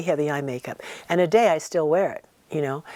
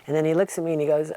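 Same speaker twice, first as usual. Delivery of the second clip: normal talking voice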